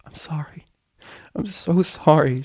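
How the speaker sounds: gasped whisper of sorrow. A narrator's reading. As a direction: gasp it out, broken. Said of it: "she gasped".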